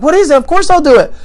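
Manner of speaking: high, loud, eager yes; eager agreement. Acted. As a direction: jump at the offer, acting thrilled. Alien eagerly agrees to the condition.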